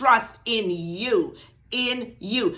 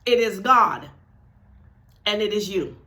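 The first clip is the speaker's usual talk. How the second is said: ordinary talk, nothing marked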